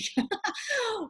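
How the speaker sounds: bright high burst of laughter at her own joke. Real, not acted. Laughing at her own joke to the viewers.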